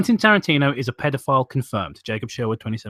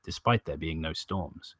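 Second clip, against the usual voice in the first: creepypasta story narration; 8 semitones lower, 8 dB quieter than usual. A narrator's reading. Narrator recalls a child's dreams of storms, sombre narration. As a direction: narrate a sombre tale, low and hushed.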